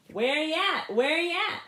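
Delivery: rally the parade behind you; loud call-and-response hail. Acted. Leading a street parade.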